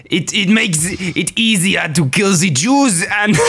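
high, strained dictator voice; Hitler voice. Acted. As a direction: play the dictator with a grin. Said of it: "Hey, Adolf!".

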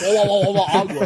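loud laughing wah-wah mimicry; mock the garble, half laughing; garbled mocking imitation. Friend mocking the failed accent attempt, laughing. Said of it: "All I heard was".

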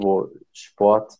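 normal talking voice

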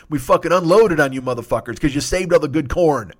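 usual speaking voice

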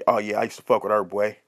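low-pitched sly aside; dropped-pitch confiding brag, not a true whisper; whispering homeboy. Acted. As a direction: imitate a friend murmuring a boast in your ear. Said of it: "your homeboy whisper".

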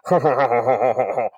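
a hint of a gloating villain, ending in a hearty mock laugh; gloat, then laugh it off; lightly voiced gloating villain. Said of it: "the laugh behind him".

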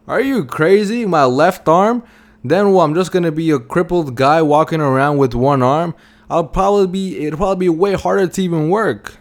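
incredulous protest. Retold or imitated. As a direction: imitate a cobbler balking at an outrageous offer. Cobbler incredulous at offer for arm.

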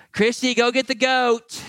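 hammed-up sigh of a sinner sending for the goat; mock weary husband. Preacher acts out Leviticus life.

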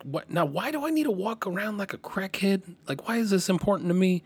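high-pitched, puzzled protest; incredulous objection. Retold or imitated. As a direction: question it in retold disbelief. Retelling his own baffled objection.

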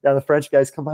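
ordinary talk, nothing marked

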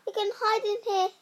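high, fast and pressing; hurried whisper-urgency. Acted. Wife rushing Jack into the jar. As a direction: hurry him in quickly.

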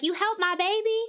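very high Southern belle voice; glam Southern belle. Retold or imitated. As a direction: squeal it like a Barbie Southern belle. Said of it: "glam Barbie Southern belle".

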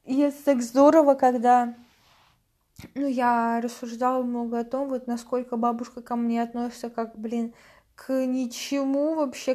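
ordinary talk

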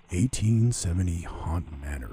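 imitated slow, grand announcer read; announcer impression. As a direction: draw it out like a haunt video host. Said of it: "I sound like the dude from".

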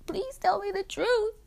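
high, whiny fake-tearful begging; mock pleading. Teen jokingly begs listeners for answers, mock pleading. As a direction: beg in a hammed-up crying squeak. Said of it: "I'm being sarcastic".

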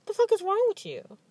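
high, incredulous outburst; mock outrage. Imagined outburst at her son's father, high incredulous outrage. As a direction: throw it at him, half laughing. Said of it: "in my head, I'm like".

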